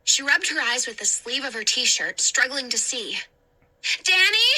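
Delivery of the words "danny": high, loud call. Acted. Hannah searching the burning room, loud high call. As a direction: call into the smoke. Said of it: "she called".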